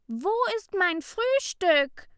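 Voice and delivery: furious high shout at the whole class; angry scream. A narrator's reading. Ida screams at her classmates over her stolen cake, furious. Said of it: "schrie sie wütend".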